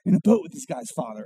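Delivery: a tired grumble to the Father; weary complaint. Retold or imitated. Jesus grumbling to the Father about the boat, mock weary. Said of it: "my perspective if I was Jesus".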